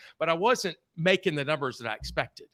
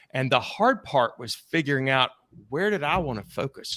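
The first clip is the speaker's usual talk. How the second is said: ordinary talk, nothing marked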